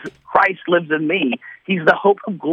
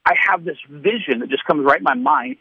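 ordinary talk, nothing marked

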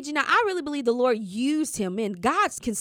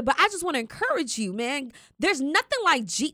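usual speaking voice

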